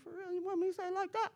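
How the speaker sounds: high, loud, rushed disbelief; incredulous. Retold or imitated. Speaker recounting a reply to God's harsh words, incredulous. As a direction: fire back in high-pitched disbelief.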